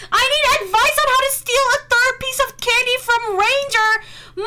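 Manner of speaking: loud, high read-out in mock outrage; high-pitched loud alarm. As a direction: read it like a scandalous confession. Reads chat question, mock alarm.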